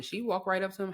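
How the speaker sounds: ordinary talk, nothing marked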